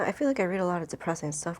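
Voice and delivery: ordinary delivery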